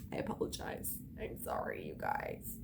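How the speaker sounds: hushed whispered sorry; softly whispered apology to viewers. Real, not acted. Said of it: "Sorry for the F-bombs".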